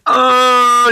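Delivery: put-on high crying little kid oh; little kid whine. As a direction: whimper like a small child. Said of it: "he starts doing this little kid".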